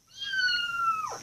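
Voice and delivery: very high, loud kitty squeal; long, piercingly high imitated meow; squeaky cat meow. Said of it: "and she squeaks".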